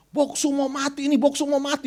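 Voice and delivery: high-pitched imitation of crying out; mock a panicked wail without shouting; retold tearful cry. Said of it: "dia teriak, dia nangis". (tiruan teriakan tangis bernada tinggi)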